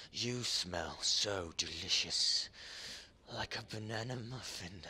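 lightly voiced creepy creature. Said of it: "I'm gonna do my best impression".

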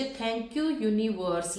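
drawn out, word by word; slow, deliberate model phrase; slow dictation. Shown as a demonstration. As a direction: spell out the phrase slowly for note-takers.

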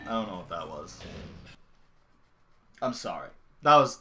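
ordinary talk

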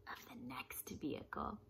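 soft whisper. Acted. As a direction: breathe it out secretly. Whispered story aside.

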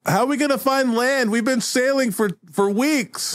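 high-pitched fretful demand; acted whiny despair; despairing crew member. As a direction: wail the question high at the leader. Crew member interrupts in complaint.

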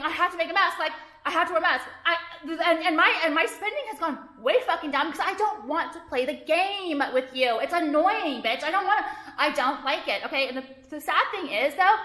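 usual speaking voice

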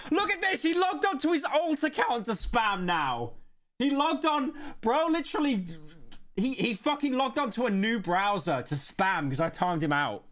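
high-pitched incredulous rant; outraged disbelief. Real, not acted. Streamer exposing a banned spammer to chat, high outraged disbelief. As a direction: shoot up high in real exasperation.

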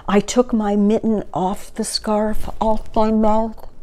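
plain reading voice

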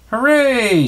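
high, loud cheer; a big joyful high-pitched hooray. Acted. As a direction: cheer like the mice just won. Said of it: "Jacques and Gus cheered".

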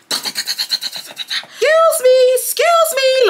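very high, drawn-out imitation yell; high-pitched mimicked holler; Chelsea yelling. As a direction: shriek it through the door like an impatient friend. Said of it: "Chelsea's just yelling it at this point".

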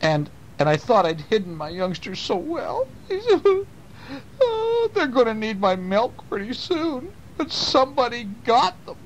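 high, hammed-up sobbing voice; mock-weeping mother raccoon; crying hard. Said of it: "was crying so hard she could barely talk".

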